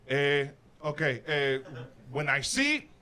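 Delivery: mock Mexican accent, slow and halting. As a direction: stumble through the answer in broken accented English. Hero answers in accent.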